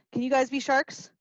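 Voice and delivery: ordinary delivery, nothing marked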